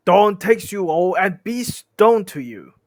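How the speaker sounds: loud, drawn-out incantation; pronouncing doom. Acted. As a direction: proclaim the curse slowly and loudly. Hidden voice pronounces trolls' doom.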